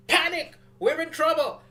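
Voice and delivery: shouted high, hammed-up alarm; mock panic. Host mocking the government's panicked warnings, loud, high mock panic. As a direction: yell it like a cartoon alarm bell. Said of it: "why it's like".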